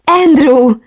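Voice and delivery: loud, high, emotional call of the name; overwhelmed, high-pitched cry. A narrator's reading. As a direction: call out to a beloved just found. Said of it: "Sikoltottam fel, és a nyakába vetettem magam".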